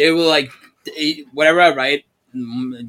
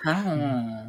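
put-on sulky teen mumble; 5 dB quieter than usual. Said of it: "Sound like an ang-, angsty teenager".

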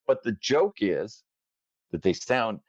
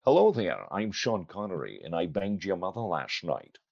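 lightly done slushy Scottish film star impression; low, soft put-on Scottish voice; Sean Connery; 4 semitones lower, 4 dB quieter than usual. Guest showing the host a Sean Connery, light impression. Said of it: "people think I do a good Sean Connery".

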